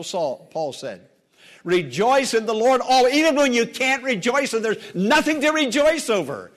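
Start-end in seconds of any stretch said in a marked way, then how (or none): 1.6-6.5 raised, forceful preaching; fervent pulpit cry; impassioned exhortation. Real, not acted. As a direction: press it on the congregation with rising fervor. Preacher urges congregation to rejoice.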